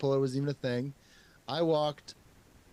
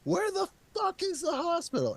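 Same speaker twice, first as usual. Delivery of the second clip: much higher, strained desperate voice; frantic repeated demand. Retold or imitated. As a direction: beg for the hospital, at the end of your rope. Over and over and over again.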